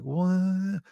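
high, drawn-out disbelieving question; mock baffled. Friend reacting to being told no, mock baffled. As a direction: imitate someone who can't believe the refusal.

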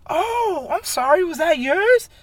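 high, falsetto mock-polite coworker; sugary fake-apology impression; mock sweet lady voice. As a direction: mimic her innocent high voice.

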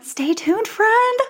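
chirpy, rising teaser; sing-song stay tuned. Acted. Playful sing-song address.

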